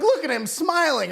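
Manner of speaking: mock mourners. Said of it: "they're like".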